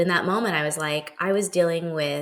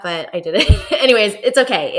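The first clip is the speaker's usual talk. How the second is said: laughing; 7 dB louder than usual. Real, not acted. Laughing at own past behaviour.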